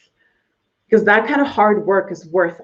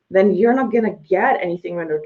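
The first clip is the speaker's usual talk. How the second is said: ordinary delivery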